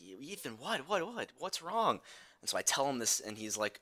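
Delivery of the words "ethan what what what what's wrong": a hint of an alarmed father, higher; lightly voiced worried dad; startled dad. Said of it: "My dad's like".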